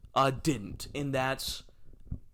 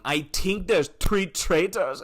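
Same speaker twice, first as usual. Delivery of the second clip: high, slow, loud put-on voice; hammed-up imitation of a contestant; mock catchphrase impression; 6 semitones higher, 5 dB louder, slower than usual. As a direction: drag it out like a tired catchphrase. Mimics a past season's catchphrase.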